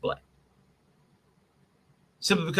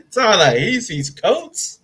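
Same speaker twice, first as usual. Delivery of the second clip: slow, higher, laughing question; 5 semitones higher, 4 dB louder, slower than usual. Real, not acted. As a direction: ask it through real laughter. Laughing at the name mixup.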